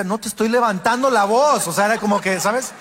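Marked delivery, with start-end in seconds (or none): 0.0-1.7 mildly raised denial. Retold or imitated. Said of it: "Yo decía".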